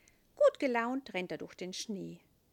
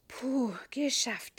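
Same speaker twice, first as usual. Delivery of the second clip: relieved sigh; 4 semitones higher, 5 dB louder, slower than usual. A narrator's reading. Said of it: "denkt Elias froh".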